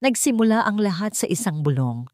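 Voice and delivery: usual speaking voice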